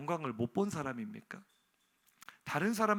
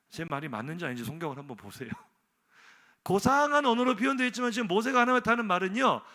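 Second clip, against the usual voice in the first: ordinary talk, nothing marked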